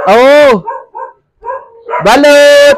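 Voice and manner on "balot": hawker's shout across the street; vendor's call. Real, not acted. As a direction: call out to buyers. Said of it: "sa pagsigaw ko".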